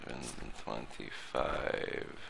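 put-on whispered low in the Eastern European accent; whispered check. Inspector checking the passport date, whispered. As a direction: mutter the date under your breath.

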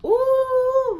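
long high-pitched "ooh"; a loud, held, high coo of delight; admiring ooh. Real, not acted. Vlogger showing off a wig to viewers, high admiring ooh.

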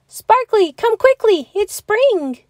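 high, loud eager call; excited calling. A narrator's reading. Bear cub calling his snowman friend, excited. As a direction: call out with urgent joy. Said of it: "shouted Little Bear excitedly".

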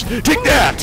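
high, loud shout; battle shout. Real, not acted. Gamer shouting as he strikes the giant. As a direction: yell it on the strike.

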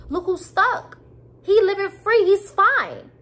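high, loud and bitter; indignant outrage. Real, not acted. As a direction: spit out the unfairness of it.

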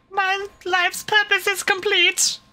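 high, airy mock of a blissed-out nurse; dreamy nurse voice. Mocking the staring nurse, high and dreamy. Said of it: "She's like".

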